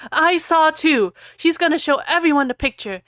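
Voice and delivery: loud, high tattling yell; angry shout. Acted. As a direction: yell it out angrily. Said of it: "Harry shouted angrily".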